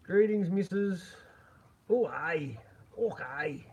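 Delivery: hammed-up Scottish accent; butchered Scottish accent. Streamer greeting a viewer's missus, mock Scottish accent. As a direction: put on a rough Scottish accent. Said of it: "butchering your accent there".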